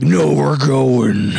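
very slow, stretched-out words; drawn-out refusal. Retold or imitated. Retelling what they told themselves, drawn out. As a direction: drag every word way out. Retold quote drawn out absurdly.

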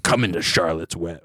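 low, soft come-hither purr; sultry dominatrix. Acted. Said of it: "a dominatrix named Charlotte".

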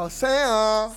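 put-on loud, drawn-out caricature of a Venezuelan accent; mock Venezuelan accent. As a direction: overdo a TV-teen Venezuelan accent. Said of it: "Que hablan como de la serie Somos tú y yo".

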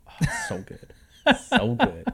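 high, gushing rave; excited. Real, not acted. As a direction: swoon over it. Raving about music.

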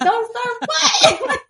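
high, slow, laughing "Don't start what?"; teasing the boyfriend while cracking up; laughing mock innocence. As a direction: play dumb through laughter.

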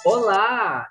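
ordinary delivery

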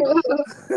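loud burst of laughter. Real, not acted. Comedian laughing at her own story, a loud laugh. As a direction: break into laughter.

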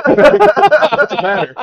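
high, loud, slow laughing question; mock concern, cracking up; laughing tease. Real, not acted. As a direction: tease her through laughter.

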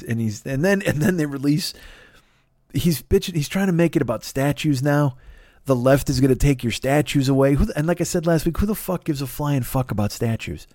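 ordinary delivery

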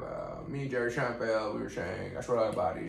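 put-on mellow movie-star voice; Matthew McConaughey. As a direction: ramble lazily like a mellow actor. Plays McConaughey talking of Seinfeld.

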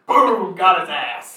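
high, loud triumphant cheer; excited shout. Real, not acted. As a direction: whoop it like a win. Celebrating the zinger.

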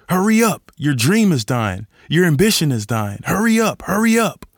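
high, loud, pushy mock nagging; culture's nagging. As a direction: hound them to hurry up. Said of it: "culture is like".

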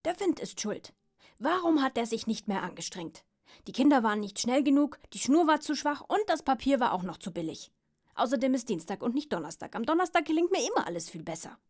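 cross pug blaming everyone and everything; annoyed. Acted. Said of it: "sagte der Mops ärgerlich".

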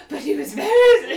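high, loud, slow mock outcry; hammed-up imitation, drawn out and loud; Charisse. Podcaster imitating a castmate harping on marriage, mock outcry. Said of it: "Charisse was like".

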